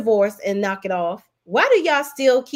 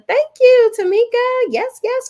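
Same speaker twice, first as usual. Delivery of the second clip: excited thanks; 9 dB louder than usual. Real, not acted. Thanking a viewer for birthday wishes.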